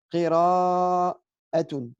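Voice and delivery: Qira'atun spoken extremely slowly, each syllable held; slow Arabic demo. Shown as a demonstration. Teacher models Qira'atun very slowly for students.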